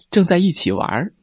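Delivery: plain reading voice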